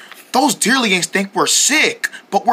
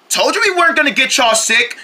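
usual speaking voice

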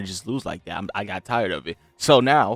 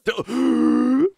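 gasp; 14 semitones higher, 9 dB louder than usual. Real, not acted. Sudden gasp mid-match.

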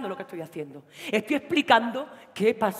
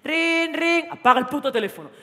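higher ring, then a snapped order; mock phone ring; 6 semitones higher, 7 dB louder than usual. Boyfriend ordering her to switch off the phone, snapping. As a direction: imitate the ring, then snap.

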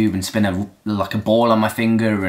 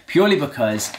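usual speaking voice

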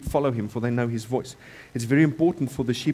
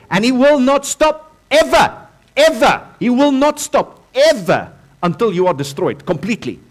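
preaching shout; 10 semitones higher, 9 dB louder than usual. Real, not acted. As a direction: thunder the warning at the congregation. Preacher warns about the devil.

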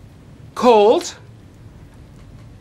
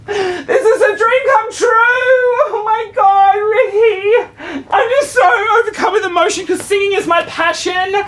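high crying shrieks of joy; overcome fan; 10 semitones higher than usual. Acted. Fan meets her idol, high tearful shrieking. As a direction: sob and squeal, starstruck.

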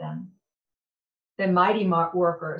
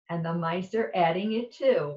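usual speaking voice

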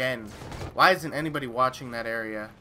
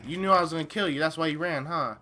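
ordinary talk

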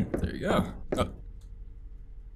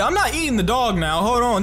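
indignant refusal; 9 semitones higher, 5 dB louder than usual. Real, not acted. Refusing to eat the dog.

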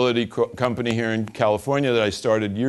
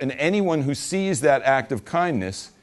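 normal talking voice

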